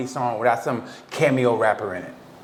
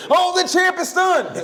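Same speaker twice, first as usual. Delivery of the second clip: shouted high announcer call, laughing; hammed-up fight announcer; slower than usual. Calling his own fight like a hyped announcer, laughing shout. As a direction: yell it like a ringside commentator. Mock boxing commentary on himself.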